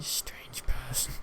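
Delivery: slow, breathy whispered remark; whispered aside. Retold or imitated. Whispering to himself about a strange classmate. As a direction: whisper it behind your hand.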